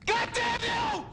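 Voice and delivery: screamed curse, very high and loud, slow; furious outburst. Acted. Man screaming a curse at his brother in fury. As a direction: explode at him in rage.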